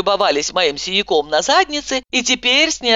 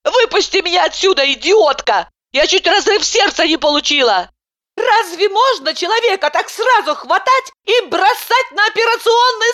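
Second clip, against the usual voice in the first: boiling rage. A narrator's reading. Patient, tied down, screams at her doctor friend furiously. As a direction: shout at her, seething. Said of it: "Я кипела".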